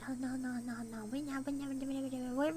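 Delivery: low, quiet stammered refusal; soft, low, repetitive muttering; flustered protest. Real, not acted. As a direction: mumble the refusals over each other. Flustered refusal to stop stream.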